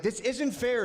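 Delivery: a hint of a put-on whine; mock complaining woman. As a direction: imitate her protesting. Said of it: "Every time she's like".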